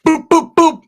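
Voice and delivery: high, loud popping hit noises; hammed-up knockout sound effects; punch sound effects. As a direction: pop each punch like a cartoon blow.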